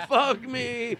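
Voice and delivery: high mock laugh on words; worst laugh imaginable. Comedian repeats his invented worst laugh, high and mock-wailing. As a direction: squeal it as a silly laugh. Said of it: "Picture him at a three hundred seat comedy club".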